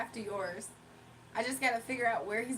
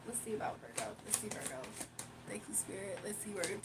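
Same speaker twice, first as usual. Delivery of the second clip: soft unvoiced murmur to herself; whispering; 3 semitones lower, 10 dB quieter than usual. Real, not acted. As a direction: whisper while concentrating. Murmurs to Spirit while pulling cards.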